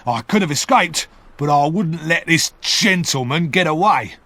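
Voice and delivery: convict boasting to the sergeant. Acted. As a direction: sneer at the idea of failing. Convict defending himself, scornful.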